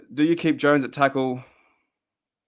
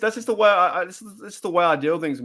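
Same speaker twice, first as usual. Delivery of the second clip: ordinary talk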